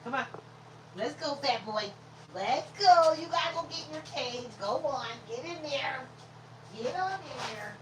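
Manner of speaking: high-pitched pet-command voice; coaxing a dog. Real, not acted.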